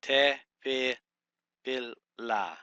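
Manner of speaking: Te, fi, fil, la in separate, deliberate beats; syllable by syllable. Shown as a demonstration. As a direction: sound out Te, fi, fil, la with gaps between syllables. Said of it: "you start the third syllable".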